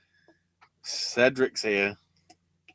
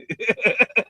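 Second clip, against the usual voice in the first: squealing high laugh; 11 semitones higher, 3 dB louder than usual. Real, not acted. Laughs after his own bit.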